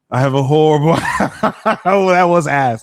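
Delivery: loud, high, laughing through the words; real laughing. Real, not acted. Tarot reader mocking their own bad attempt, laughing loudly. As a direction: crack up at your own failure.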